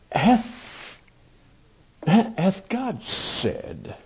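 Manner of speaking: high, very slow, drawn-out tempter; serpent's voice. Acted. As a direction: stretch hath out like a sly serpent. Serpent tempting Eve in Genesis.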